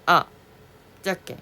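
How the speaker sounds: 아 clipped short like Chinese. Shown as a demonstration. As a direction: cut 아 off short. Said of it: "중국어처럼 짧게". (중국어처럼 짧게 끊은 아)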